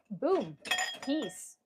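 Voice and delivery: ordinary talk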